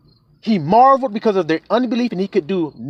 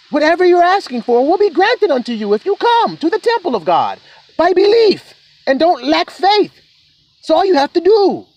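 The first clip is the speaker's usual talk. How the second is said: lifted, higher preaching voice with weight on come; rising preaching; 7 semitones higher, 3 dB louder than usual. Acted. Preacher promising the flock their asking will be granted, lifted preaching. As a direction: urge the flock to believe.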